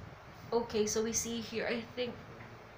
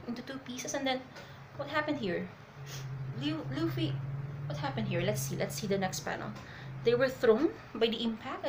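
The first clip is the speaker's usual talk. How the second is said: normal talking voice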